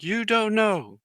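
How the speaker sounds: high-pitched mock disbelief; drill sergeant. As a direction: throw the answer back like an incredulous sergeant. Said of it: "he's like".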